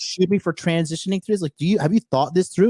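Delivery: mock outrage at the guards; retold outburst. Retelling clash with armed guards, incredulous mock yell.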